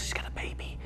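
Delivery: whispered with a gasp; shocked whisper. Acted. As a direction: gasp the reveal softly.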